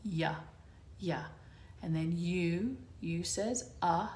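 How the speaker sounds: "Ya" and "uh" said as clean sounds; letter sounds. Shown as a demonstration. Teacher to class, clear letter sounds. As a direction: sound out "Ya" then "uh". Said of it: "regular Y sound".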